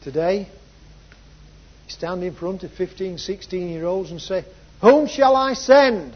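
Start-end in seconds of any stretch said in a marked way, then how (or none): 4.8-6.1 loud, high, slow call; proclaimed divine challenge; God. Acted. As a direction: call it out as a ringing summons. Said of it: "the voice of the Lord saying".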